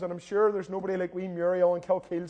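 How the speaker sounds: normal talking voice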